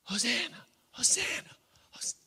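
thin high mock cheer, held back in volume; half-hearted out-of-place Hosanna shout; inappropriate praise cry. Shown as a demonstration. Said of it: "It would be inappropriate in a way to".

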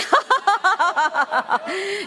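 high, rolling laugh at own joke; burst of laughter. Real, not acted.

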